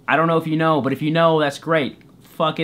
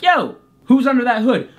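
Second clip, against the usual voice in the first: high, raised indignant demand; indignant challenge; 7 semitones higher, 4 dB louder than usual. Retold or imitated. Dreamer confronting the hooded guy, indignant. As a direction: call out a stranger, affronted.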